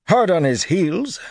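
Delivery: high, slow scoffing echo; disparaging. A narrator's reading. Wyraman doubts the armsman's report, disparagingly. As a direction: question it with slow disdain, pitch lifted. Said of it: "Wyraman murmured disparagingly".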